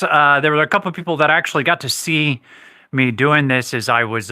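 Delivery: ordinary delivery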